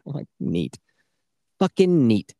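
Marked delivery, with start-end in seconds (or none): 0.4-0.8 low, flat sarcastic "Neat."; deadpan. Retold or imitated. Gamer mocking a grim game moment, dry deadpan. 1.6-2.4 louder, higher sarcastic "Fucking neat."; exaggerated sarcasm. Gamer mocking a grim game moment, hammed-up sarcasm.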